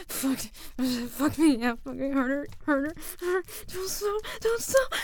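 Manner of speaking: loud, rising ecstatic cries; climax cries. Real, not acted. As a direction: build to a loud peak.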